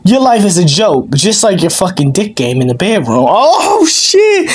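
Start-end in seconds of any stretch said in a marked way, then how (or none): 3.3-4.5 high, sudden "oh shit"; shocked. Real, not acted. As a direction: caught off guard, blurt it out. Shocked by a personal jab.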